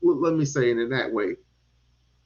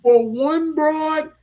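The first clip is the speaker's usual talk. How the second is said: indignant challenge; 7 semitones higher, 6 dB louder than usual. Retold or imitated. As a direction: hit it hard and drag out the disbelief. Retells own indignant challenge.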